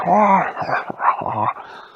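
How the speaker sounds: high, loud robot laugh; a burst of cartoon laughter. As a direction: animatronics laughing together. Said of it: "And they all laughed".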